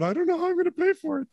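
high, fast whining complaint; whiny voice. Retold or imitated. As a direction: whine it like a spoiled passenger. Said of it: "one is whining about".

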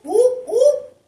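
excited oop. Real, not acted. Reader reacting to the cards, loud excited oop. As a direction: yelp it out in delight.

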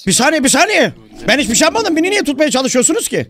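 high, hammed-up plea of innocence; panicked protest. Captive to captors tying his hands, panicked protest. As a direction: protest as they grab you.

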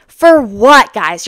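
loud, exasperated for what. Real, not acted. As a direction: throw the question at them. Exasperated question to therapists.

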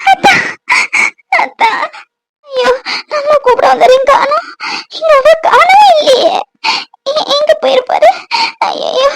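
high, sobbing cries of panic; crying. Acted. A frightened wife crying out high for her missing husband. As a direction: wail high for your fainted husband. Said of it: "அழாத".

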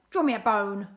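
loud barked demand; forceful, punchy dog voice; barking dog. A narrator's reading. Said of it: "barked Bruce".

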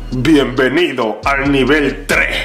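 low, slow epic announcer; dramatic announcer. Acted. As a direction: announce it like a movie trailer.

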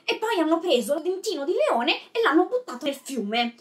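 high, whiny mock prince; hammed-up childish royal complaint; Joff. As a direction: complain like a tattling little prince, pitch jumping up. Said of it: "imitazione accuratissima".

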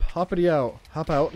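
ordinary delivery

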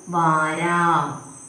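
വാരാം stretched out very slowly; very slow spelling. Shown as a demonstration. Teacher sounding out the word for pupils, very slowly. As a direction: draw out each syllable of വാരാം. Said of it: "ആം ചിഹ്നം കൊടുക്കുമ്പം".

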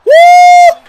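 high, loud Ric Flair "Woo!"; full-throated showman woo. Pretend. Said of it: "That's how you woo".

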